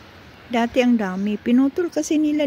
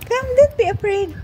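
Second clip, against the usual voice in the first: high soothing pet voice; calm the frightened kitten; 9 semitones higher than usual. Real, not acted. Coaxing the scared cat, high soothing baby talk.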